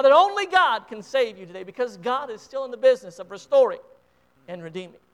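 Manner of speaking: impassioned revival preacher pleading with the congregation; preaching at full force. Acted. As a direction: build it up and urge them toward the altar. Altar call at full force.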